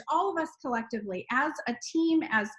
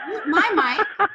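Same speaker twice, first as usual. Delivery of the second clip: louder, laughing as she backs off; 6 semitones higher, 7 dB louder, slower than usual. Real, not acted. Host handing the call to Stacy, laughing and louder. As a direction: chuckle while handing over.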